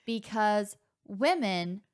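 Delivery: slow, word by word with long pauses; reluctant, halting argument. Pretend. Sister forced to argue an assigned side, halting delivery. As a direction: grope slowly for each word.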